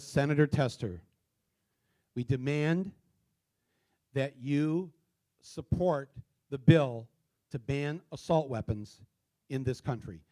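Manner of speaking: measured phrases with long pauses; dictation. Real, not acted. Speaker dictates a message for the audience to type, halting dictation. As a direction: dictate it in short chunks, pausing after each.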